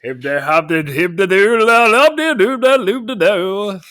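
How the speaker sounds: babbling through a mouthful; garbled gibberish as if mouth stuffed; mouth full. Pretend. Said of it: "That's how I sound with a mouthful of crabs".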